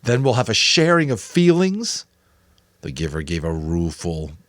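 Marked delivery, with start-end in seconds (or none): 0.0-2.0 louder, higher sneering jab; mock the ritual bitterly; boy sneering at his mentor's plan; nasty sarcasm. A narrator's reading. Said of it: "the nasty, sarcastic voice again".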